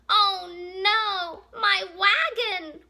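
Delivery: loud, high, drawn-out storybook wail. Acted. Worm laments the broken wagon in a picture book, mock wailing. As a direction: lament it slowly as a hammed-up cry. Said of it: "Worm wailed".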